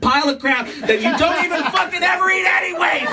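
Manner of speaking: hammed-up husband tirade climbing to a scream; angry rant. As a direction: build to a high, strained shout. Husband ranting at wife in argument.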